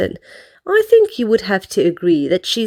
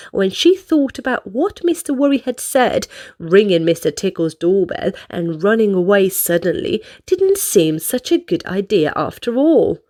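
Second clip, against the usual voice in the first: ordinary delivery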